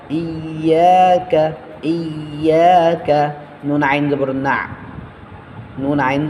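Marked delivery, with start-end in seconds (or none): 0.1-3.3 ইয়াকা slowly, long yeah held; one-alif stretch. Shown as a demonstration. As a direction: draw out yeah before a short কা. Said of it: "এক আলিফ টানিয়ে পড়তে হবে এই জায়গায়". (ইয়াকা এক আলিফ টেনে পড়া)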